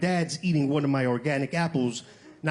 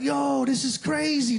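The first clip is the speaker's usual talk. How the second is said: drawn-out, high amazed marvel; slow and wide-eyed, taking it all in; high, slow amazed gasp of wonder; 7 semitones higher, much slower than usual. Retold or imitated. Amazed at Whole Foods doors.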